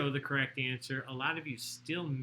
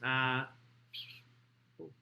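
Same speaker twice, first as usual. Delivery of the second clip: nose whistle on the words; whistling nose; 3 semitones lower, 5 dB quieter than usual. Real, not acted. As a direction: air whistling through the nose. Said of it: "oh, my nose is whistling".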